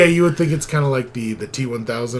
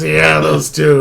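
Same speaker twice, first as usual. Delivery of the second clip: smug dismissive; 4 semitones higher, 7 dB louder, slower than usual. Retold or imitated. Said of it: "they're like".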